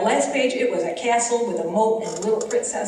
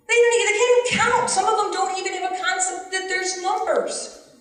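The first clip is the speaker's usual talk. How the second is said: exasperated outburst; 10 semitones higher than usual. Real, not acted. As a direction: let the frustration spill out. Teacher's exasperation over testing.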